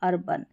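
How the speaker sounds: "अर्बन" with a short, weak "-bən"; correct schwa ending. Shown as a demonstration. Teacher gives learners the correct reading, short schwa. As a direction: say "अर्बन" softly, last vowel reduced to "uh". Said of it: "इसको अरबान नहीं पढ़ेंगे".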